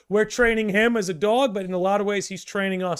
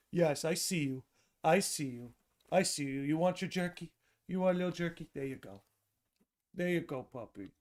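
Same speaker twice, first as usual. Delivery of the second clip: gentle pet-talk, hushed and tender; dog talk; 2 semitones lower, 9 dB quieter than usual. Real, not acted. Streamer hand-feeding jerky to his dog, soft cooing.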